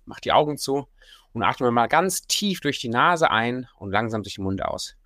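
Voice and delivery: sultry narrator. Acted. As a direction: talk them calmly through each breath. Leads a breathing exercise.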